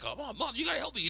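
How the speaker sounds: high-pitched frantic daughter voice; Candace. Retold or imitated. Teen calls her mom for help, high pleading. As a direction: plead in a squeaky put-on voice. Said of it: "And then Candace is like".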